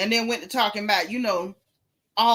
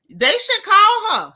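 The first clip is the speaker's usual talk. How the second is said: emphatic punchline; 11 semitones higher, 9 dB louder, slower than usual. Real, not acted. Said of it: "loud slow punchline".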